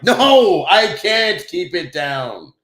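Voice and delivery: loud, slow defiant refusal; gymnasium voice. Retold or imitated. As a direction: boom it out slowly like a gym coach. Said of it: "especially with my gymnasium voice".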